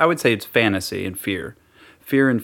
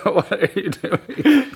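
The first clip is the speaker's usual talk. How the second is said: laughing; 12 semitones higher, much slower than usual. Real, not acted. Laughing at partner's silly drum roll.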